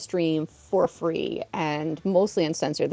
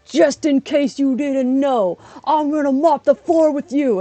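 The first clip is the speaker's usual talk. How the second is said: loud, high-pitched mock ring speech; wrestler promo; 7 semitones higher, 6 dB louder, slower than usual. Imitating coworker's wrestling speech in the ring, loud promo voice. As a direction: belt it out like a wrestler's taunt. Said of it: "doing his speeches going".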